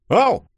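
high, loud yelp; pained yelp. A narrator's reading. Denis pinched on the shoulder by Yulia, yelping. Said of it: "взвизгнул Фадеев".

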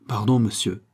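plain reading voice

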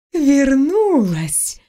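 высокий, протяжный возглас русалки; воскликни радостно, растягивая слово; радостная ундина. A narrator's reading. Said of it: "Ундина обрадовалась мне настолько сильно". (певучая радость водяной девы при встрече)

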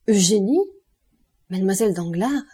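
astonished question, not hushed; murmure stupéfait. A narrator's reading. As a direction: recognise her in disbelief. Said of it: "murmura Calvacanti, passant de l'effroi à la stupeur". (stupeur interrogative)

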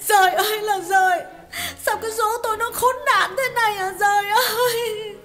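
betrayed wife's sobbing cry; wailing lament. A narrator's reading. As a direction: wail to heaven through tears. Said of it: "Rồi Hà bật khóc hu hu".

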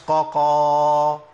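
drawn-out "ققا", the kaf wrongly thickened into qaf; kaf turned to qaf. Shown as a demonstration. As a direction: chant "ققا" with two heavy qafs, long and loud. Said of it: "إبدال الكاف بقاف".

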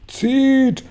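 high, drawn-out cry; shouted command. A narrator's reading. Grasshopper orders everyone to haul the rope, high shout. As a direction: call the order out high and long. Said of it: "schrie Grashüpfer".

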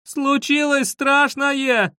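very high, slow, drawn-out cry; high, insistent wail; desperate calling out. A narrator's reading. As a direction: shout it through a door, stretching each word. Repeats the cry through the door.

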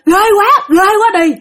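high, panicky whine of terror; frightened wailing. Acted. Father tells his wife a ghost scared him, high panicked wail. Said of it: "Là ba la vậy đó".

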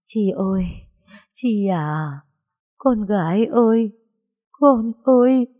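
a mother keening her dead daughter's name; pleading lament. A narrator's reading. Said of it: "dì khẩn khoản nỉ non". (nỉ non than khóc, vỡ òa ở cuối)

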